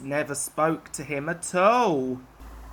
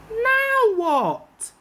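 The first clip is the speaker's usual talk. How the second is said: alarmed; 5 dB louder than usual. Acted. Alarmed fireman asks.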